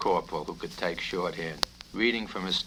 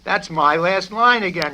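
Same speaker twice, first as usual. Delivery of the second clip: much higher and louder shriek; shrieking colonel; 11 semitones higher, 11 dB louder than usual. Acted. Colonel shrieking at the corporal, purple with anger. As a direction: shriek in frustration. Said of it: "shrieked the colonel".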